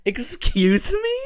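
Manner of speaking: very high, slow, squealed "Excuse me?"; an exaggerated shocked squeak of disbelief; mock outrage.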